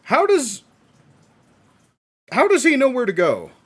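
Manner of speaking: high, loud disbelieving question; baffled. Real, not acted. Viewer confused by the character's route, high baffled question. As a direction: ask in real disbelief.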